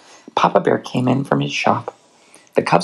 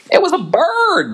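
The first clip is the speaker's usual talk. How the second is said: put-on high, eager child bear voice; a cub blurting out a fib, high; little cub voice. Cub tells the whopper.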